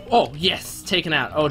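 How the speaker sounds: ordinary talk, nothing marked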